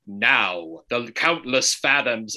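slow, weighty narrator voice; fancy enunciating narrator. Retold or imitated. Host imitating the video's narrator, slow enunciated fancy voice. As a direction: draw out each word with fancy, careful diction. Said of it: "because he was enunciating".

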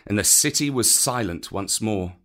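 plain reading voice

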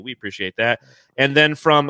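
usual speaking voice

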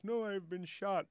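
high, drawn-out punchline in character; put-on high, slow voice of a wounded clerk; wounded joke character. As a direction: stretch it out high, like a shot man in a joke. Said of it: "he's, like, mumbling".

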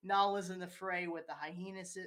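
ordinary talk, nothing marked

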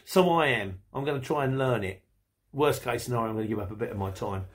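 ordinary talk, nothing marked

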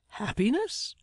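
high questioning retort. A narrator's reading. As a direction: throw the word back in high-pitched disbelief. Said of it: "retorted Mister Meagles".

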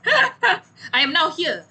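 slow and laughing. Real, not acted. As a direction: laugh it out slowly. Laughs while greeting the hosts.

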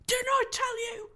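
high, loud gleeful voice; crowing that he was right; triumphant beaver. Acted. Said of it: "answered Mister Beaver".

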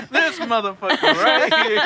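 Samuel L. Jackson. Retold or imitated. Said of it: "rather have Samuel L".

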